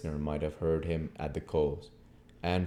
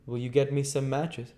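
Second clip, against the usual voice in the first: abrupt; 9 semitones higher, 3 dB louder than usual. A narrator's reading. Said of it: "quite abruptly".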